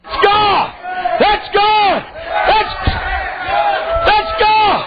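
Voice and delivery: shouting fervent preacher; preaching. Acted. Preaching at full force.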